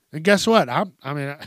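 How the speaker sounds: ordinary delivery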